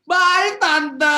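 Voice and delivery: high, loud answer. Retold or imitated. Wife answering her Medan aunt, loud and high. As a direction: push the voice up to match loud relatives. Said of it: "Suaranya dilatih lebih keras".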